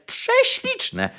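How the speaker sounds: prześliczne squeaked very high with delight; squealed prześliczne. A narrator's reading. Sparrow squealing with delight to the fir tree, very high. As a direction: shriek prześliczne in bird rapture.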